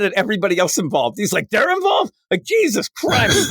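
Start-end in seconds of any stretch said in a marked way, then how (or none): 1.5-3.5 outraged shout. Retold or imitated. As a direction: bellow it like a furious doctor who just found out. Bones mad at everybody involved.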